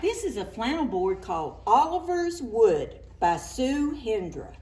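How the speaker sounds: ordinary delivery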